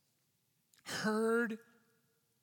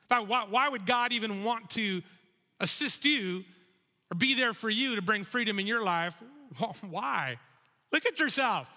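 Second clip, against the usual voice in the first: a higher, louder sneer at someone's hope; sneering scoffer; 4 semitones higher, 3 dB louder than usual. Acted. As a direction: belittle them, half laughing. Acted scoffers belittling the seeker.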